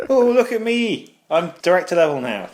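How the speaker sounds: a show-off colleague bragging to the widower, high and smug; smug boaster. Retold or imitated. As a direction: crow about the promotion in a high mocking voice.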